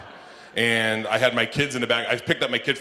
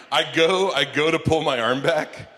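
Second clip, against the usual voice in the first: ordinary talk, nothing marked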